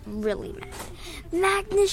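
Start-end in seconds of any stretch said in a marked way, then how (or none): 1.3-1.9 high, restrained shriek. A narrator's reading. Alex mad and shrieking, high restrained shriek. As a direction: shriek the name, held back. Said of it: "she shrieked".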